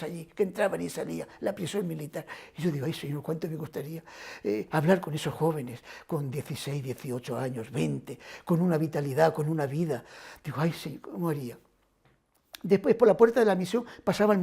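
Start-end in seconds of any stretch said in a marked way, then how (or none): 2.8-10.0 softly retold plea to God, much softer; hushed prayer. 10.6-11.6 softly retold plea to God, much softer; hushed prayer.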